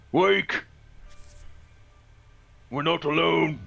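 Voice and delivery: loud, high-pitched shout to wake everyone; gruff call-out. Acted. Adventurer waking sleeping companions in the tree, gruff shouted warning. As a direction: bellow it out gruffly so the voice carries. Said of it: "In a gruff voice".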